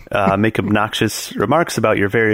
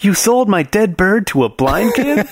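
mock outrage; 9 semitones higher than usual. Mock outrage, quoted comedy line.

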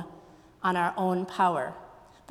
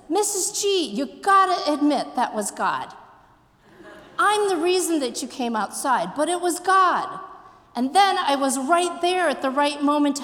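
put-on high, louder, excited kid pleading a case; acted pupil's excited insistence, pitched up; insistent student; 5 semitones higher, 4 dB louder than usual. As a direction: imitate an eager student arguing with the teacher. Student insists to teacher, retold.

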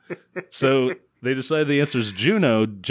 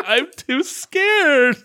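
high whimper; much slower than usual. Retold or imitated. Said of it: "She's like".

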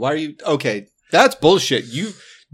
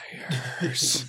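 whispered accusation, softer than usual talk; 6 dB quieter than usual. Pretend. Player looks down at lying goggles and answers, whispering. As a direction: whisper it under your breath.

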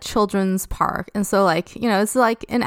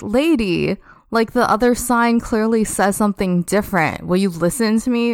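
ordinary talk

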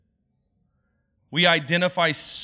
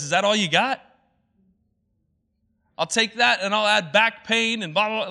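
louder and higher one-upping brag; boastful challenge; 5 semitones higher, 4 dB louder than usual. Retold or imitated. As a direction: outdo the other guy's sufferings. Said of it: "someone says".

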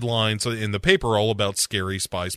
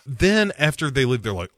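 ordinary delivery

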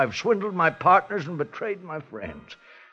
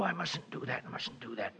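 softer and lower, trailing off; fading murmur; 5 semitones lower, 13 dB quieter than usual. Acted. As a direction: murmur to yourself while nodding off. Old man dozing off, scolding himself.

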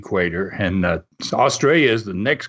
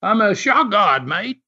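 higher-pitched Aussie lifeguard, R's dropped; imitated Aussie accent; 8 semitones higher than usual. Aussie lifeguard explaining his job to a tourist, imitated Aussie accent. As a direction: state it higher, in broad Aussie. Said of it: "that accent".

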